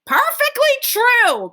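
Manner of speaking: put-on high, slow old lady's exclamation; a high old woman agreeing gleefully; old woman's cry. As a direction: cry it out as an eager grandma. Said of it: "cried Grandpa Josephine".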